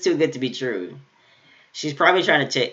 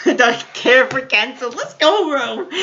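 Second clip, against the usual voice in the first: excited; 9 semitones higher, 4 dB louder than usual. Real, not acted. Hyped at the anime.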